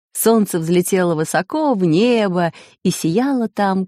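ordinary delivery

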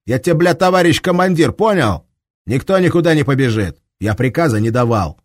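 high, loud furious command; furious shout. Acted. Commander shouts his rank at Shams, furious shout. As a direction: bellow the order, stress товарищ. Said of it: "злобно ответил Лихолетов".

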